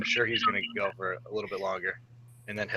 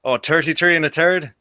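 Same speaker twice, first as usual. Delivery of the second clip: "thirty-three and a third" said with Irish "tirty-tree and a tird", higher and louder; Irish accent; 7 semitones higher, 9 dB louder than usual. Shown as a demonstration. [name] taking Brian's challenge to say an Irish phrase, Irish accent. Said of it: "can you say this, [name]?".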